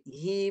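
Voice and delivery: "Him" drawn out a little; lengthened. Shown as a demonstration. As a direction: stretch the vowel in "Him" for the learner. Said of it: "Tarik sikit".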